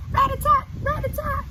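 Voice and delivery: high, loud tapping rat-a-tat; woodpecker rat-a-tat. Shown as a demonstration. Teacher recalling woodpecker sound, high loud. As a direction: rap it out like a beak. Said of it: "the sound of the woodpecker".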